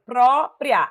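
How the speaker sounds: "Própria" em pró-pria, com o pró em destaque; sílaba tônica marcada. Shown as a demonstration. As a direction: marque a primeira sílaba de "Própria". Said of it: "a sílaba tônica tá aqui". ("Própria" dividida em duas sílabas)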